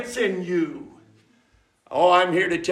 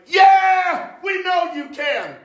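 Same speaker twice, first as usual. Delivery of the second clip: cheering crowd; 11 semitones higher, slower than usual. Retold or imitated. Crowd calling back to the tightrope walker, eager cheer.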